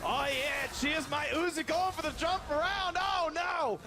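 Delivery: a put-on Australian accent, cheery and keen. Co-caster asked for the replay in an Australian accent, so he hams it up. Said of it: "Can you do this replay in Australian accent".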